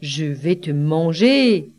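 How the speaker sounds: low-pitched storybook wolf; low wolf menace, drawing out the threat; hungry wolf. A narrator's reading. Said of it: "dit le loup".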